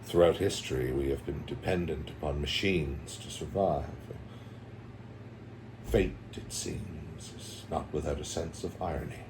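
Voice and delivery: solemn movie quote, lightly done; Morpheus quote. Retold or imitated. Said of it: "Uh, Morpheus".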